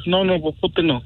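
ordinary talk, nothing marked

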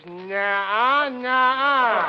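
drawn-out bleat, climbing on the repeat; goat bleat. Acted. Reading a farm primer aloud, imitating a bleating goat. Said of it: "and the goat says".

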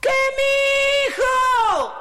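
very high, loud, stretched holler; old man's shout back. Acted. Old Tata answering his boy from afar, long high shout. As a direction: holler back across the countryside. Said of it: "le dijo otra vez el viejito".

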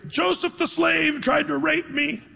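high-pitched imitated cry of alarm; mock yell. Potiphar's wife falsely accuses Joseph, high-pitched mock yell. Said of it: "and she yells out".

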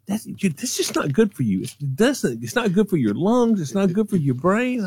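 mom warning. Retold or imitated.

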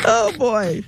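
normal talking voice

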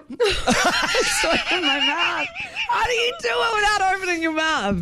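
high, laughing and struggling; laughing through words. Real, not acted. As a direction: giggle through frustrated words. Trying closed-mouth singing.

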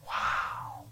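breathed, almost soundless wow; a whispered wow of quiet amazement; awed hush. Real, not acted. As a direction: let the wonder take your voice away. Awed reaction to the adoption story.

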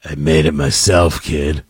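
creepy, very low growled offer; Harrison Ford. Pretend. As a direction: drop way down and make it creepy. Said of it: "just with the right amount of creepy".

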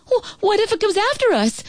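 high, stammering cry of worry; alarmed cry. A narrator's reading. Brother fears the monster will chase them, high alarmed cry. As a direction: cry it out high, tripping on the first word. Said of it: "my brother Bill cried".

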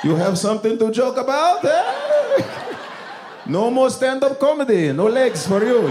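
put-on high-pitched menacing Middle Eastern accent; angry Middle Eastern man impression; mock Middle Eastern accent. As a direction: threaten the comic in a hammed-up Middle Eastern accent.

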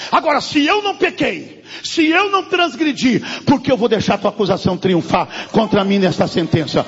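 loud declaration of refusal; loud, defiant sermon cry; defiant preaching. Acted. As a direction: proclaim it like Paul defying his accusers. Preaching Paul's defiance loudly.

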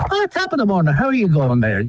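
a light Irish brogue with a high sing-song "Oh"; imitating a cheery Irish priest's greeting; a hint of an Irish brogue, high-pitched at the start; Irish accent. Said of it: "a little Irishman".

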